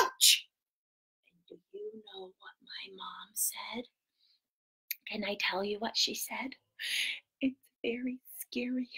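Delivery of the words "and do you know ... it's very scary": low, hushed spooky whisper; scary whisper. Acted. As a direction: build suspense in a whisper. Scary part of the story.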